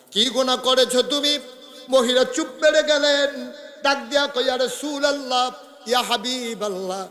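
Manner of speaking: high-pitched chanting sermon delivery; impassioned preaching. A narrator's reading. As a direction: preach it high and urgent, holding line endings. Preacher chants the dramatic exchange. (সুরেলা উঁচু গলায় ওয়াজ)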